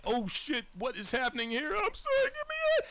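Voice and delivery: retold child's tearful panic, voice climbing very high; crying. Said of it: "And I start crying".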